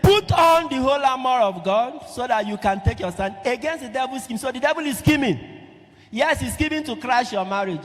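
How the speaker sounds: high, loud scripture proclamation; forceful preaching. Real, not acted. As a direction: thunder the verse to the congregation.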